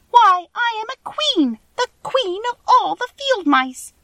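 very high, squeaky, affronted queen; indignant little squeaky royal voice; indignant mouse queen. A narrator's reading. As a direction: announce yourself grandly in a tiny squeak. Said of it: "cried the little animal indignantly".

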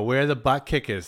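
lightly mocked Boston accent; playful Boston-accented furniture shopper. Shopper walking into the furniture store, mock Boston accent. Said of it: "Very Boston".

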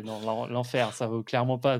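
ordinary talk

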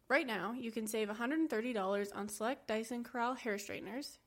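smooth sponsor read, soft and even. Acted. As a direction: read it like a podcast ad spot.

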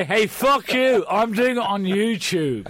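exaggerated rebel yell; mock defiant shout. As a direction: crow it at the gatekeepers, mockingly. Mocking writer defying the industry.

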